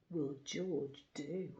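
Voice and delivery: ordinary delivery, nothing marked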